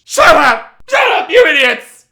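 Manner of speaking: high, very loud hammed-up yelling; imitated furious shout. Guy at the computer yelling at chatty friends, mock furious shout. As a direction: explode at them at full volume. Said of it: "this dude was at, like, a ten".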